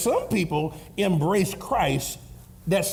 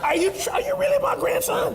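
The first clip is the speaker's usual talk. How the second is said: incredulous shout. Acted. Grandpa exasperated at grandson.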